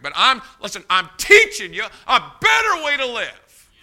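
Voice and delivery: loud, high, driving sermon climax; full-force preaching. Real, not acted. Pastor urging the church to trust God, preached hard and loud. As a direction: hammer it home to the congregation.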